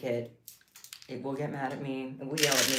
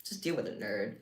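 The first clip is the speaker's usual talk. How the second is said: mock bully; 7 semitones lower, 5 dB quieter than usual. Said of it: "It's like".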